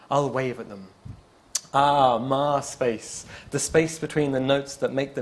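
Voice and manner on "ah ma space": usual speaking voice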